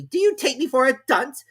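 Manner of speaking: high, loud and sharp; high, loud sharp king. A narrator's reading. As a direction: snap at the Hatter. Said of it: "said the king sharply".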